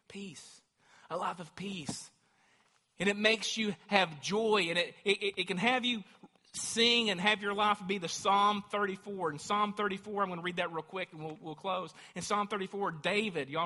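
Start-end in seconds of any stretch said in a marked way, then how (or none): 3.0-9.3 full-force preaching. Acted. Preacher urging the congregation toward joy, loud fervour. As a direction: preach it out with power.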